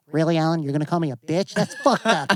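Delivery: lightly squeaky mock complainer; mock the offended friend in a silly voice. Mocking someone who took the banter as an insult, lightly put-on whiny voice. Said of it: "that voice".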